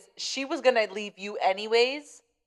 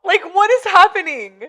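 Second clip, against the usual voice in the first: louder, higher, words through laughter; laughing hard; 8 semitones higher, 11 dB louder than usual. Real, not acted. Friend cracking up at the story, laughing hard. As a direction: barely hold it together, laughing.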